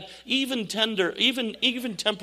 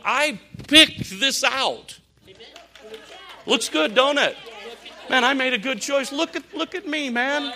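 showing off the outfit, higher and louder; proud show-off; 7 semitones higher, 4 dB louder than usual. Pretend. Pastor showing the church his outfit, proud and loud. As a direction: strut like you nailed the look.

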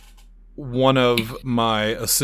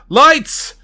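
a sharp, much higher and louder call; barked order; 14 semitones higher, 8 dB louder than usual. Acted. Magician shouts an order to the technicians. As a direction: yell the command across the stage.

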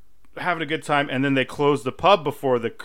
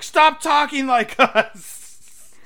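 higher and louder, slow teasing scold; slow playful rebuke, much higher; mock scolding; 12 semitones higher, 8 dB louder, much slower than usual. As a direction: tell him off through a laugh. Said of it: "She's like".

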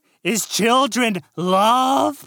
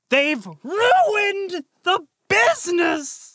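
despairing wail; 8 semitones higher than usual. Acted. Dean despairs.

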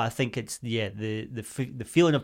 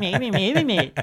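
put-on silly childish voice; 9 semitones higher, 3 dB louder than usual. Shown as a demonstration. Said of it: "repeated back what she said in a silly voice".

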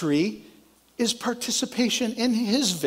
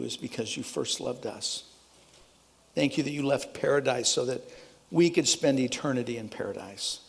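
lower, hushed prayer voice; pastoral prayer; 7 semitones lower than usual. Acted. Pastor praying over the congregation, hushed and reverent.

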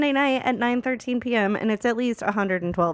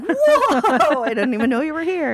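mock startled whoa; 4 semitones higher, 7 dB louder than usual. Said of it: "And I was like".

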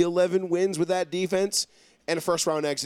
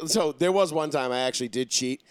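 usual speaking voice

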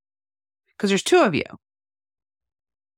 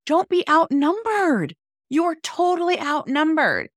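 much higher, emphatic retold warning; retold mom warning; 12 semitones higher than usual. Mother retelling her warning to her teenage daughter, high and insistent. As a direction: hammer the point like a worried mom. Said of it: "And I was also like".

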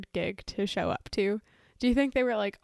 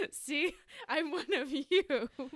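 laughing politician impression; 6 semitones higher than usual. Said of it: "a politician being like".

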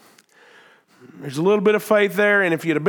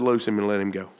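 low, hushed command, much softer; quiet Jesus; 11 semitones lower, 6 dB quieter, faster than usual. Retold or imitated. Preacher repeating Jesus's words in a low hush. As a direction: say it low and soft, almost to yourself. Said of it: "Jesus said".

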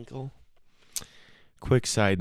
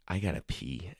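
quiet aside; 11 dB quieter than usual. Real, not acted. Comic telling folks at home he needs the bathroom, softly. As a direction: murmur it like a quick confession.

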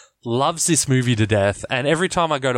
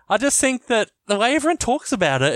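ordinary delivery

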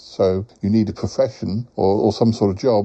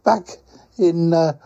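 high, sing-out character voice with quacks; cheerful quacking creature; 8 semitones higher than usual. Acted. Quacking friend greets Paul on a call, high cheerful creature voice.

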